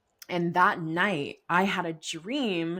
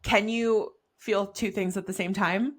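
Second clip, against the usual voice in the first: ordinary delivery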